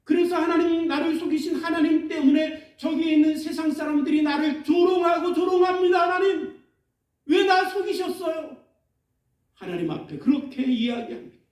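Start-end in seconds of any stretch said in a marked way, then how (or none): 0.0-8.6 high, rising accusing lament; prophet's raised, half-shouted grievance; Jeremiah's complaint. Retold or imitated. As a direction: accuse God of deceiving you. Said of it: "예레미야 선지자가 하나님 앞에 뭐라 그러냐면".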